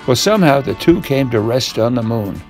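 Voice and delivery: ordinary delivery, nothing marked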